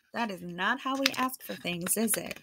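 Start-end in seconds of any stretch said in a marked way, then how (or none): none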